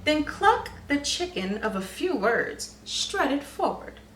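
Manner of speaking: usual speaking voice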